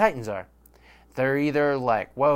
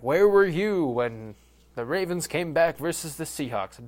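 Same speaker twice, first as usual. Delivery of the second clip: mock dramatic question; 4 semitones higher than usual. Imagined fan asking question.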